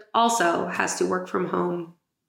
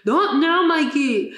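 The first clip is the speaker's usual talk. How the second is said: Toto doll catchphrase; 7 semitones higher, slower than usual. Retold or imitated. Toto's talking doll snapping at Mikey, hammed-up. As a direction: play it like a pull-string talking doll. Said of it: "Toto's would be like".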